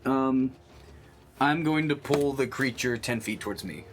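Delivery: ordinary talk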